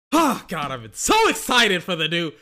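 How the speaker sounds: loud, high-pitched fan hype, building to shouting; hammed-up excitement exploding into cries of "God"; juiced-up excitement. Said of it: "Got a little bit juiced up".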